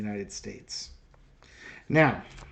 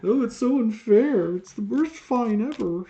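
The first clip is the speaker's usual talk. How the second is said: higher, whining mock complaint; mocking whiny supporter; 8 semitones higher than usual. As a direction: parody a sulking fan's excuse. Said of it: "What type of excuses are they".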